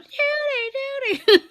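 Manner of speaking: high chirping "Judy" call; high mimicked birdsong; bird call imitation. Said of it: "the other one's going".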